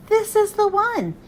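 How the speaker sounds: high, eager declaration; thrilled little frog; excited. Acted. Froggy finds his pumpkin.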